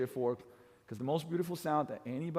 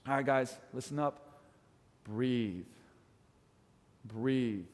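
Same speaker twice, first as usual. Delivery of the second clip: calm cool-down; 4 semitones lower, much slower than usual. Shown as a demonstration. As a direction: settle the class down gently. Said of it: "when I bring the music down".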